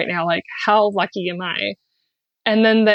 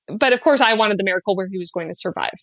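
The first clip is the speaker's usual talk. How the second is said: normal talking voice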